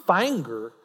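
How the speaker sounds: "Finger" with a country twang; "Finger" with the vowel stretched country-style; country accent. Retold or imitated. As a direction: say "Finger" as a drawled "fainger". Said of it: "listen to our accents".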